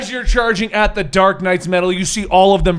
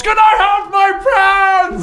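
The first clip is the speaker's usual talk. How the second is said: a much higher, louder, slow far-off yell; Bane yelling; 12 semitones higher, 6 dB louder, slower than usual. Acted. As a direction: howl it after them as a pitiful villain. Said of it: "Bane yells".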